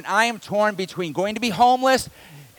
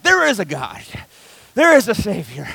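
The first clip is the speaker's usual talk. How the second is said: joyful relief; 4 dB louder than usual. Retold or imitated. Relief after mortgage error fixed.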